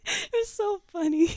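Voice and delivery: high, squeaky words through real laughter; laughing too hard to talk; helpless laughter. Real, not acted. Cracking up at her own breakup story, helplessly laughing.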